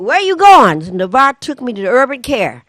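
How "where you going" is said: high loud mock call; kids calling out. Kids at the window calling to her, loud mock shout. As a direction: call after her like an excited kid.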